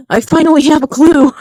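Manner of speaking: triumphant finally; loud relieved excitement; excited exclaim. Real, not acted. Excited at finding clue.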